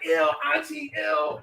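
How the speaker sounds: put-on high, grossed-out child; kid's high squirm. Child squirming from auntie's kisses, high. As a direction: squirm away from the kiss. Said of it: "You like".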